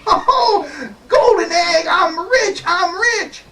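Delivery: high-pitched gleeful squealing; mock jubilant. Jack finding a golden egg, high acted glee. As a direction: crow with excitement, way up high.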